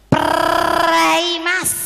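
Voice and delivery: very loud, high trilled shriek; squawking hen refusal. Acted. Hen rebuffing rooster's advance, squawking loudly. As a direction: burst out with a rolled, squawked "Prei". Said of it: "dia ngomong ngeten".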